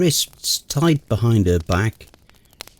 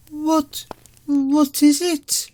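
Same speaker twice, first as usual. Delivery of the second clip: much higher, slow nervous question; much slower than usual. Acted. Girlfriend hears rustling in trees, nervous question. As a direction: ask it with fear rising. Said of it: "Mara asked nervously".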